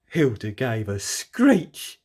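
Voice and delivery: usual speaking voice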